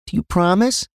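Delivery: put-on high-pitched little girl voice; young daughter. Young daughter calling to her dad at night, high little-girl voice. Daughter asks father to promise.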